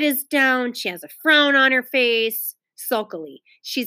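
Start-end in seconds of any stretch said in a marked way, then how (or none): 2.8-3.4 sulkily said softer and lower; the teacher mimicking a sulky tone. Shown as a demonstration. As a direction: drop the voice on sulkily. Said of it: "check out Ramona".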